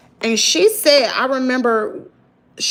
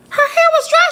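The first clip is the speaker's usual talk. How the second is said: higher and louder, hitting HAIR; louder outraged repeat; 6 dB louder than usual. Real, not acted. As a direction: shout it even harder the second time. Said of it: "repeated louder".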